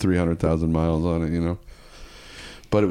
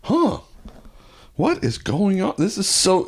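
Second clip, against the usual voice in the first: much higher, bewildered huh; can't believe the lights are back; baffled surprise; 11 semitones higher than usual. Retold or imitated. Power guy baffled when the lights suddenly work, high bewildered.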